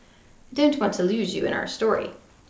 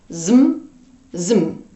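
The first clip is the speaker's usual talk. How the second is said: lower, louder punchy zoop; zoop sound effect; 5 semitones lower, 3 dB louder than usual. A narrator's reading.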